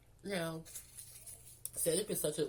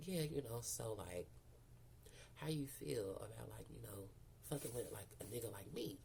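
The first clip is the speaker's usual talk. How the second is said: low, soft come-on; murmured pick-up line, imitated; imitated flirting man; 4 semitones lower, 8 dB quieter than usual. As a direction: drop low and quiet, as if propositioning someone. Man leaning in, flirting, retold.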